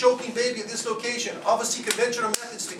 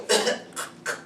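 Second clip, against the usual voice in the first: small imitated cough; baby cough. Shown as a demonstration. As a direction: fake a baby's cough. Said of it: "does the baby go".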